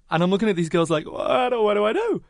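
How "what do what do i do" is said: mock panic. Acting out helpless moment with her friends.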